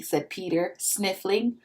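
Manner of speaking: plain reading voice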